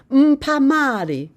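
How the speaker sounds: put-on high, slow child's begging call; children calling out. A narrator's reading. Children crowd the visitor begging for sweets, high calling voice. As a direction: sing it up like a kid asking for sweets. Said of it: "calling out the greeting".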